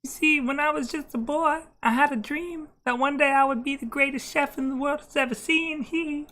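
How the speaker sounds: [name] impression. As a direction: speak in a high [name] falsetto. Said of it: "I just do a [name]".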